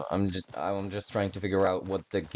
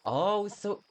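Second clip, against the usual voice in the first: thrilled gushing; 11 semitones higher than usual. Real, not acted. Gamer gushing to viewers over the journal, high and thrilled.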